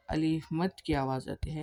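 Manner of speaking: normal talking voice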